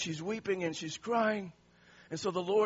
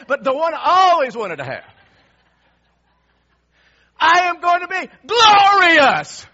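much louder, high, triumphant; exultant shout; 6 semitones higher, 14 dB louder than usual. Pretend. Preacher imagining his glorious body, loud and triumphant. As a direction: shout it to the rafters. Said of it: "But I imagine it".

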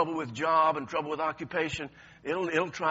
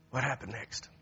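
normal talking voice

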